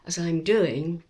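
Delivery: normal talking voice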